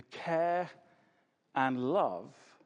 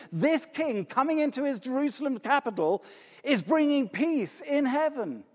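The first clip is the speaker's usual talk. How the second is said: ordinary talk, nothing marked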